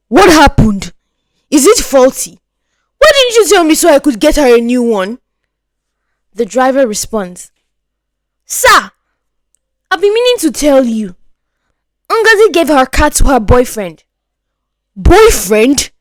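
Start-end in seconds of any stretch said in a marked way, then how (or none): 0.1-5.2 higher, louder, faster demand; demanding father. Acted. Father to the driver, loud and demanding. 8.5-14.0 higher, lightly voiced servant; cautious confession to the boss; driver reporting. Driver to his boss, higher and careful. 15.0-15.9 loud, rising disbelief; shocked question. Acted. Father to driver, shocked.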